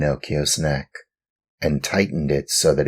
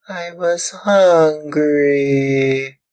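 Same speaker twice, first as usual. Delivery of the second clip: high, slow, drawn-out whine; whining puppet boy; 13 semitones higher, 5 dB louder, much slower than usual. Acted. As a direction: stretch it out like a pitiful child. Puppet pleads to glowworm.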